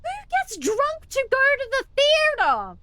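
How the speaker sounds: high, loud disbelief; incredulous. Real, not acted. Outraged rhetorical question.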